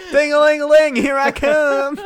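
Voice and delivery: high, loud singsong southern call; Phantom Bell man. Pretend. Ghost bellman approaching in bell shoes, loud southern call.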